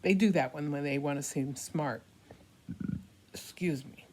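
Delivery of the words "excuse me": whisper. Real, not acted. Whispered aside.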